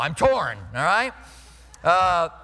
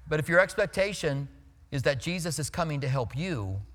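ordinary talk, nothing marked

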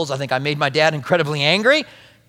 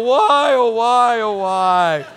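higher, drawn-out hammed-up child wail; mock wail; 4 semitones higher, 3 dB louder, much slower than usual. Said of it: "him wail".

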